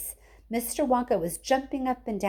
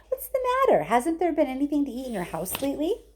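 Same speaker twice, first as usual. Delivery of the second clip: fussing Wonka; 7 semitones higher, faster than usual. A narrator's reading. Wonka fussing over Grandpa.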